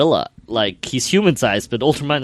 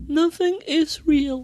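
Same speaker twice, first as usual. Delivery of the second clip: slow, high, flat robotic voice; 12 semitones higher, slower than usual. Retold or imitated. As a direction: imitate a creepy robot girl, slowly. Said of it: "She's like a robot".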